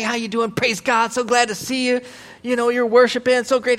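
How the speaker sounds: mock gushing, high-pitched friendliness; best-behavior church greeting. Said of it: "you're on your best behavior".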